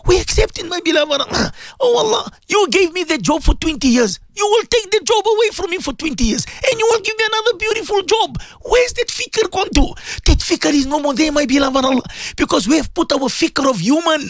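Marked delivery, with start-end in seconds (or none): none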